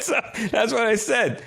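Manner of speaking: cracking up at himself as he tells it; giggly embarrassed admission; laughing. Real, not acted. Laughing at his own awkwardness.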